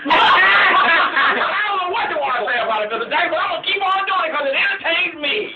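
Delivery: laughing and high through the words. Real, not acted. Host laughs while answering Joe Jackson's complaint.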